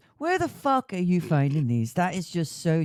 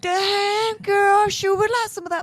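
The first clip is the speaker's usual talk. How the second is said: put-on silly ham girl voice; 6 dB louder than usual. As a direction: draw it out in a high put-on voice.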